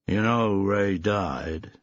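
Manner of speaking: slow, rehearsed American action-hero voice; slow borrowed American twang, practised menace; slight American twang. A narrator's reading. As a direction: deliver it like a movie tough guy. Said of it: "slight American twang".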